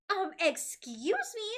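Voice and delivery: high, slow, loud excuse me; screamed. Acted. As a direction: shriek it at the teacher. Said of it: "Zizi screamed to the guru".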